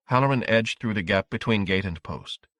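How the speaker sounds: ordinary delivery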